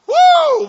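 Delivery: very high, loud whoop; crowd shout. Retold or imitated. Congregation cheering the preacher's line, whooping loudly. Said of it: "people would clap and shout".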